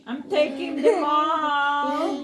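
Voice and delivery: laughing and drawn out. Real, not acted. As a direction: laugh it out while gloating. Gleeful card win.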